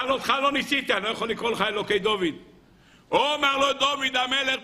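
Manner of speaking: usual speaking voice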